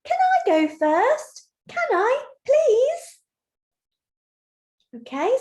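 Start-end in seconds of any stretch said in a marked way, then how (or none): 0.0-3.2 much higher, slow, eager little goat voice; a tiny goat begging to go first; Little Billy Goat Gruff. Shown as a demonstration. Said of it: "said by Little Billy Goat Gruff".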